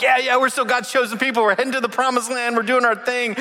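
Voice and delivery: high breezy self-assured voice; carefree and sure of yourself; smug believers. Acted. Israelites brush off their sin, high breezy smug voice. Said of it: "They're like".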